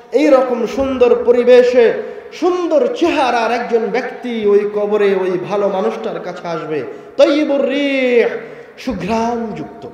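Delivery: very loud, high proclaiming sermon; preaching at full force. Acted. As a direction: proclaim it like a call from heaven. Preacher rising again.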